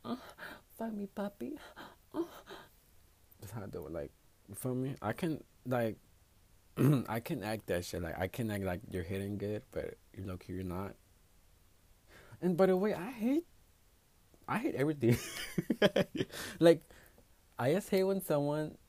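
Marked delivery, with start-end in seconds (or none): 0.0-2.3 high, airy femme moan, a touch softer. Shown as a demonstration. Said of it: "if you want me to be a fan". 12.4-18.8 heated outburst. Real, not acted. Rant about selfish lovers.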